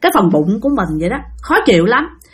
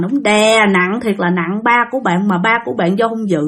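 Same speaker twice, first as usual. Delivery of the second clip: usual speaking voice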